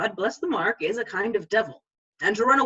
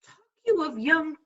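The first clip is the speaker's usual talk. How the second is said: slow, higher, rising tease to the father; teasing question; 8 semitones higher, slower than usual. Acted. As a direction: bait the old man. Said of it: "Mark me now, now will I raise the waters".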